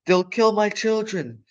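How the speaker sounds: panicked mother fox; fearful cry. Acted. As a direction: cry out for her children in terror. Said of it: "cried Missus Fox".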